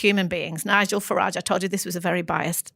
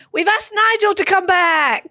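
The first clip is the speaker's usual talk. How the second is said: higher, louder, slow hammed-up announcement; mock announcement; 10 semitones higher, 5 dB louder, slower than usual. Party leader announcing Nigel's return, mock grand. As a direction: ham it up like a grand stage reveal. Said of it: "resigned live on the stage and said".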